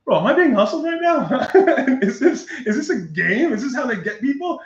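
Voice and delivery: high-pitched, half-laughing disbelief; incredulous protest. Retold or imitated. Driver suspects police are hustling him, high incredulous protest. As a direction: question it in mock outrage.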